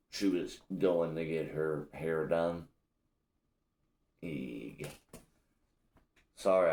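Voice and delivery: ordinary talk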